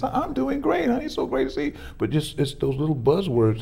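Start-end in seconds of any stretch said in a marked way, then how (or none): 0.0-1.7 much higher, sugary fake cheer; overly sweet reply. Husband greeting wife after she asks how he is, over-sweet. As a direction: switch on a syrupy grin for her. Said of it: "my face changes".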